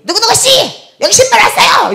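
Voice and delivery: high-pitched calling-out yell. Retold or imitated. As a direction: imitate a feisty lady hollering for someone. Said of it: "이거 소리 지르는 거예요".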